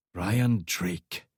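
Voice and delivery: lightly voiced menacing Scot; low and menacing. A narrator's reading. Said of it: "his voice low and menacing".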